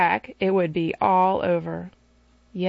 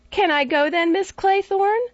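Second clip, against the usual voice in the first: put-on high, pleading child's voice; a small boy begging his governess to let him swim; little boy; 11 semitones higher, 3 dB louder than usual. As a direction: whine like an eager little boy. Child Cyril voiced again.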